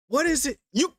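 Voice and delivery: ordinary talk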